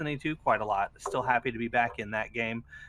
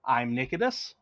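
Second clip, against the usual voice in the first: bright, raised announcer opener; show host intro; 7 semitones higher, 4 dB louder than usual. Acted. Host opening the podcast for listeners, upbeat announcer read. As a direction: welcome the audience like a show host.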